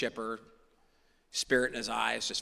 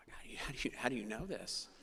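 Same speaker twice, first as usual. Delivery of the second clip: whisper; 12 dB quieter than usual. Retold or imitated. Stunned reply to sponsor's guess.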